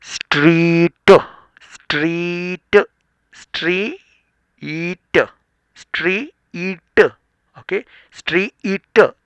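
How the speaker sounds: स्ट्रीट drawn out slow and loud, s-t-r cluster stressed; sounding out street. Shown as a demonstration. Teacher sounding out स्ट्रीट for the class, slow and loud. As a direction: stretch स्ट्रीट slowly, leaning on the str. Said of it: "मैं आपको आवाज़ निकालता हूँ".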